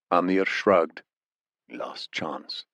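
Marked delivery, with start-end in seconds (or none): 1.7-2.6 low, soft menacing voice; a quiet velvet threat; menacing interrogator. A narrator's reading. Amir cold dismissal.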